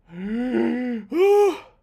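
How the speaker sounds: gasp. Real, not acted. Shocked by subscriber count.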